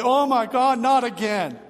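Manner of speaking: high, loud anguished wail; hysterical crying cry. Retold or imitated. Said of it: "crying hysterically".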